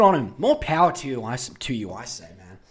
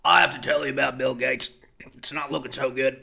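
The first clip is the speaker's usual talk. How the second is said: Alex Jones impression; 3 semitones lower, 3 dB louder than usual. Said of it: "he comes out like this".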